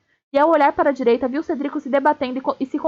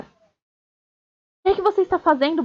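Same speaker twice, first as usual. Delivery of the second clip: raised-pitch, mildly yelled demand; berro narrado; 5 semitones higher, faster than usual. A narrator's reading. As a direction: demand it in an understated, higher-pitched cry. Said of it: "berrou a voz de Cedrico". (berro contido e indignado)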